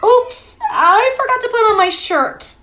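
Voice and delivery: high, squeaky embarrassed cry. Acted. Froggy cries out, embarrassed, to his mother. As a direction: yelp it out, caught without a shirt. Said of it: "cried Froggy".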